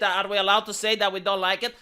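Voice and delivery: ordinary delivery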